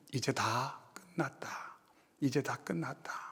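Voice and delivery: hushed, broken whisper of defeat; whispered despair, barely audible. Acted. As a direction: breathe it out as if all hope is gone. Despairing disciples voiced.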